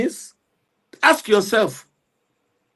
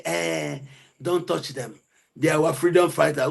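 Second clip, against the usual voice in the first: mock their soft, pleading plea, much softer; hushed, scornful mimicry of the defenders; mocking imitation; 5 semitones lower, 7 dB quieter than usual. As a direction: sneer their words back at them quietly. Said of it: "you say".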